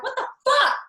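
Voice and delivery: high, loud waking outburst; shouted curse on waking up; startled yell. Retold or imitated. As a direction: blurt it out, jolted awake and furious. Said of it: "and I'm like".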